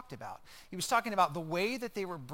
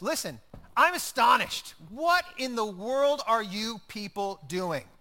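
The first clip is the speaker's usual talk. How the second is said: louder, raised voice of a shocked apostle; astonished outburst; 3 semitones higher, 7 dB louder, slower than usual. Retold or imitated. Paul scolding the Galatians: loud, astonished rebuke. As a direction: let the disbelief burst out loud. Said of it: "This is what he says".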